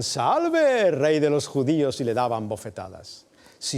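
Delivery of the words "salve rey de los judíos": loud, high sneering hail; mocking hail. Soldiers jeering at Jesus, high mocking shout. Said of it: "Acercándose le decían".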